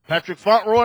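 loud, formal roll-call name; mock teacher reading the register. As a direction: call out the name across the classroom.

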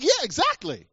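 high-pitched, eager agreement; emphatic agreement. Retold or imitated. Jesus agrees with his disciples, retold with high-pitched emphasis.

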